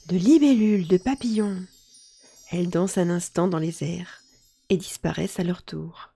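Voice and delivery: ordinary delivery, nothing marked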